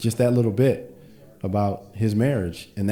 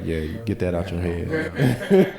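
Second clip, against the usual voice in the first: laughing retort; slower than usual. Retold or imitated. Wife's retort voiced with laughter.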